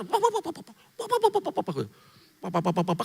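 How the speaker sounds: high rapid babbling papa papa; glossolalia babble imitation. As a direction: mimic his excited tongues like bubbling water. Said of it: "kayak ban kelelep".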